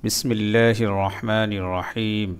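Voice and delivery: slow, soft Quranic recitation. Acted. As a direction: intone it gently like a prayer. Opens with recitation.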